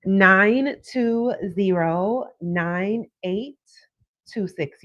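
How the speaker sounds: each digit spelled out slowly; slow dictation. Shown as a demonstration. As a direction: read the digits slowly for writing down. Slow dictation of phone number.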